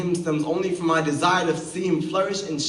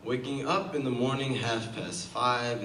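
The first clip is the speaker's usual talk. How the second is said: ordinary delivery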